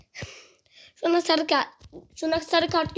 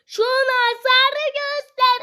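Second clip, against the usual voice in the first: higher, louder, slow demand; slow, raised yell for a yellow card; angry demand; 8 semitones higher, 4 dB louder, slower than usual. Real, not acted. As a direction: drag out the demand at the referee.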